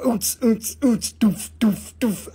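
mouthed club beat, oots and doof. Retold or imitated. Host mimics the disco doof for viewers, mouthed beat. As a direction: thump out a little dance beat with the mouth. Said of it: "It's like a doof".

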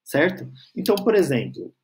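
usual speaking voice

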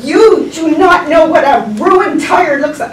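high, loud mock outrage; acted-out indignant customer; exasperated scolding. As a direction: scold in comic disbelief.

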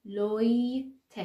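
slow "لوی", a gap, then short "ت"; syllable-by-syllable repeat. Shown as a demonstration. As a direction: lengthen "لوی" and detach the "ت". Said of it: "تکرار کنید".

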